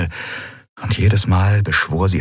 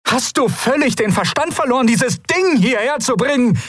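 shrill, furious cry, far higher; furious outcry. Acted. Lien screaming at Garlet for bringing the weapon, furious. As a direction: hurl the words at him in outrage. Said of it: "rief sie".

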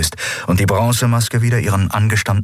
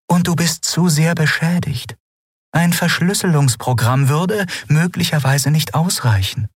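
higher, gentle explaining voice; Aylan's voice; 8 semitones higher than usual. Acted. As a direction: explain it carefully to the machine. (sanfte, erklärende Stimme)

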